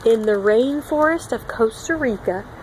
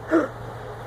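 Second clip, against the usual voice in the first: surprised gasp; 5 semitones higher, 6 dB quieter than usual. Real, not acted. Amazed at toad's back.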